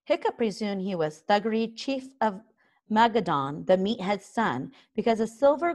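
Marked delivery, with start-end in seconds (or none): none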